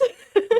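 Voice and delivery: short loud laugh. Real, not acted. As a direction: laugh out in disbelief. Laughing in disbelief.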